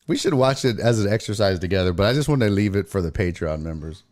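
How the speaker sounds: ordinary talk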